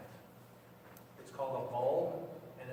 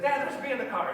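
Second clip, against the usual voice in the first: put-on high, loud frightened child's cry; a boy warning dad in alarm; scared boy; 8 semitones higher, 6 dB louder, faster than usual. Boy warns dad about the bee, frightened.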